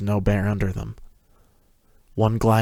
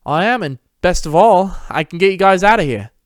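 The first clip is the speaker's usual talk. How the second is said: higher, louder, bold voice; confident boy; 10 semitones higher, 6 dB louder than usual. Acted. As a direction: sound strong and sure. Confident Peter speaks.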